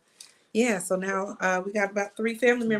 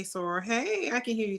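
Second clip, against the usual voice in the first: usual speaking voice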